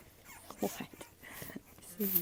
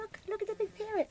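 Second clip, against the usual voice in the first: puppy talk; 12 semitones higher than usual. Real, not acted. Owner cooing high at her puppies.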